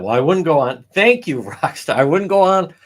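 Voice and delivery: normal talking voice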